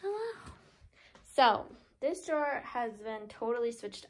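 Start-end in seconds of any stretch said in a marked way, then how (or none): 0.0-0.5 kitten voice. Real, not acted. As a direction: coo softly up high at a sleeping kitten. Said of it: "Please excuse my kitten voice".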